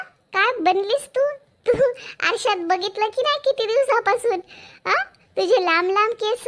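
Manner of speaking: put-on stunned old friend scolding in disbelief; shocked exclaiming. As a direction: gasp at how she's changed. Shocked at friend's changed look.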